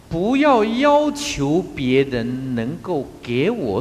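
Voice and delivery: 不要要求 said slowly, stress on 要; slow, measured quote. Shown as a demonstration. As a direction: lay out the maxim slowly. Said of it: "有讲过一句话". (缓慢地一字一字说“不要要求别人能够给我”)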